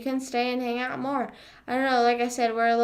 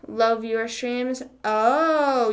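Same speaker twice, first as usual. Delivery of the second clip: normal talking voice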